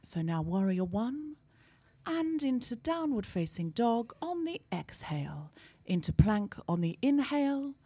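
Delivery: slow, hushed, breathy-soft yoga instructor; soft yoga teacher voice. Retold or imitated. Mocking calm yoga teachers in Camden, softly slow. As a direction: drift through the poses in a soothing whisper. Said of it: "everyone talks like this".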